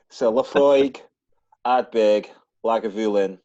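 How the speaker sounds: whisky names in a hammed-up Scottish accent. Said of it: "you have to say it in a Scottish accent".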